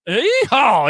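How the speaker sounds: high, loud answering whoop; loud mock cowboy holler; hollered yeehaw. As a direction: whoop it back playfully.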